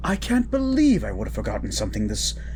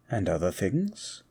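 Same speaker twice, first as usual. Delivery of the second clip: normal talking voice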